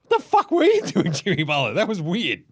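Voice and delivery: high incredulous laugh-through question; laughing disbelief. Retold or imitated. Crowd mocking Jimmy Butler's last play, laughing. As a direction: crack up at his blunder. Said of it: "Everyone's like".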